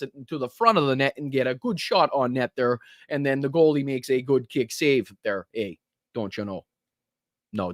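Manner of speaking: lightly put-on Canadian accent with eh; a hint of a hockey announcer from Canada. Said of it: "good accent there, [name]".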